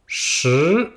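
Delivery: "Shi" with a small E, rising from mid to high. Shown as a demonstration. Teacher models "Shi" for students: rising tone. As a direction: lift "Shi" upward, from the middle to the top. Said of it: "c'est le ton montant".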